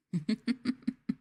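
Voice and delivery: a short high taunting laugh; mocking laugh. Teasing listeners she calls dirt, a mocking laugh. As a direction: laugh down at them.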